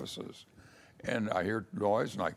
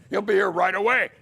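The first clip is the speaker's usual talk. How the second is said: higher, louder and rushed, nervous promise; panicky reassurance; 10 semitones higher, 6 dB louder, faster than usual. Retold or imitated. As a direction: blurt it out fast to cover for the absent boss. Stalling judge, lawyer missing.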